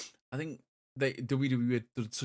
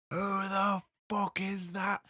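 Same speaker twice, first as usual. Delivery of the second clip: high, slow furious yell; angry scream; 8 semitones higher, slower than usual. Retold or imitated. Fan retelling yelling at strangers, high and angry. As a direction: yell it at the people beside you. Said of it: "Screaming to these next people".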